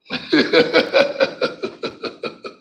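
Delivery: unvoiced laugh; breathy laugh. Real, not acted. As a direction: laugh under your breath.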